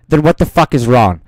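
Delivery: very loud, furious outburst; exasperated yell. Retold or imitated. Student to math teacher after baffling verdict, yelling in exasperation. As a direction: explode at the teacher in disbelief.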